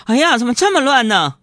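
high, loud mock dismay; high loud mock alarm at own mess; startled cry. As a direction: gasp it like a nasty shock. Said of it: "吃了一惊".

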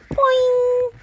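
high springy cartoon boing; high boing noise. Reader imitates the wig popping off, high boing. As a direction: bounce the word up high.

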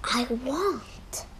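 slow greedy whisper. Acted. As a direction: breathe it out slowly. Pirate gloats quietly.

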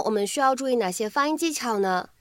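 ordinary delivery

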